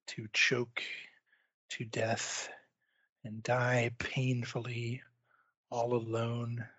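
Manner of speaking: hushed, lingering game-master doom; grave guilt-tripping narration. Acted. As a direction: let each phrase hang, quietly.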